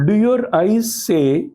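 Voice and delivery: normal talking voice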